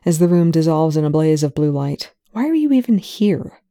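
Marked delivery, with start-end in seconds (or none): 2.3-3.6 higher, irritated question; annoyed. A narrator's reading. Telford irritated at Young, annoyed. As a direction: snap it with annoyance.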